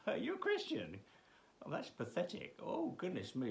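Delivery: mock the believer in a high scornful voice; mocking scoffer.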